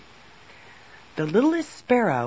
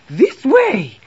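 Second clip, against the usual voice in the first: high, loud worried queen; urgent cry; 8 semitones higher, 8 dB louder than usual. A narrator's reading. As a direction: cry out to lead them onward. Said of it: "cried the Moon Queen".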